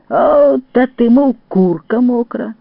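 high, loud, drawn-out exclamation; astonished. A narrator's reading. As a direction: exclaim slowly in amazement. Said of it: "здивувався".

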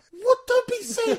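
high, loud, laughing disbelief; incredulous girlfriend. Retold or imitated. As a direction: play the scoffing girlfriend. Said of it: "Anna will be listening to this going".

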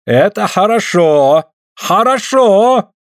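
high, slow approving exclamation; pleased drawl. A narrator's reading. Suль approves of Andrei's hit, pleased drawl. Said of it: "протягивает довольно Суль".

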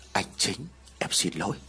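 soft, low tearful whisper; tearful apology. A narrator's reading. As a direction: apologise through tears. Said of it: "Tân rơi nước mắt giàn giụa". (giọng nghẹn ngào xin lỗi)

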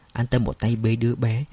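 usual speaking voice

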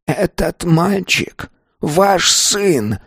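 high, slow words through gritted teeth. A narrator's reading. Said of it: "сквозь стиснутые зубы". (сквозь стиснутые зубы)

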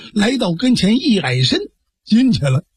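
usual speaking voice

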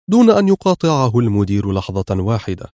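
ordinary delivery, nothing marked

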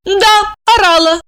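high-pitched admission; agreeing. A narrator's reading. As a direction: own up to it readily, pitched high. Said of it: "согласилась она".

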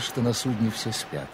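ordinary talk

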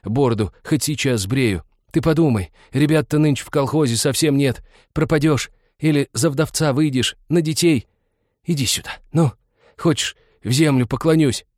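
insistent plea climbing in pitch; anguished, mounting persuasion; desperate pleading. A narrator's reading. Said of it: "тоскливо шептал странник".

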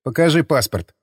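usual speaking voice

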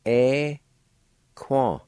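"Equan" said carefully as one clear syllable pair; teacher modelling "Equan"; model pronunciation. Shown as a demonstration. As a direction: give "Equan" a clean model reading. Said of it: "is pronounced like this".

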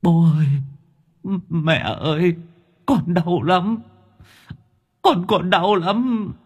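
put-on high, drawn-out child's whimper; whining, put-on crying. A narrator's reading. Captured ghost child begs parents, whining tearfully. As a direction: plead in a high tearful whine. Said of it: "bây giờ dở giọng khóc lóc".

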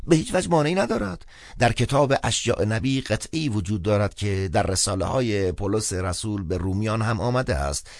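high-pitched clergyman reciting with emphasis; chatty phone priest, quoting scripture. Acted. Priest quotes scripture to the caller, high and emphatic. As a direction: reassure briskly, then recite scripture in a high voice.

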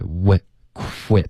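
"quit" with airy kw, voice arriving on the w; voice on release. Shown as a demonstration. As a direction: open rounded lips into a voiced "w", then "quit". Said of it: "嘴唇分开的这个动作就会有音出来".

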